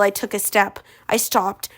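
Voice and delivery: plain reading voice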